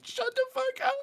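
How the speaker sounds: squeaked out through helpless laughter; wheezing laugh squeal. Retold or imitated. Retelling a drunk laughing fit with his buddy Travis, squealing through laughter. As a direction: gasp it high while cracking up.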